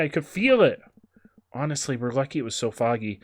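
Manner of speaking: ordinary delivery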